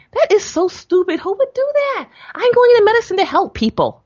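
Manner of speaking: high, loud outraged protest; indignant protest. Retold or imitated. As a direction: push back hard, pitched high. Daughter protesting to father.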